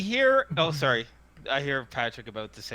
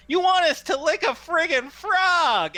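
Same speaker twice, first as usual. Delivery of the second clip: put-on high, loud nasal teen heckle; nasally voiced teen; 9 dB louder than usual. Teen in the crowd mocks the hawker, loud nasal voice. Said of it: "a nasally voiced teen".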